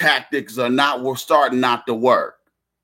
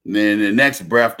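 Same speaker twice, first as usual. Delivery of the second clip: ordinary talk, nothing marked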